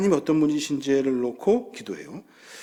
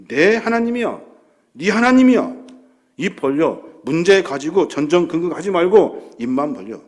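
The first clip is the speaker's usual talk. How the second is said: God; 3 semitones higher, 5 dB louder than usual. Acted. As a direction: proclaim it forcefully, as the one in charge. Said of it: "야, 내가 누구야?".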